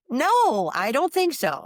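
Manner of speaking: acting out a balking "no way"; retold refusal. Woman refusing friend's word that she must return, protesting.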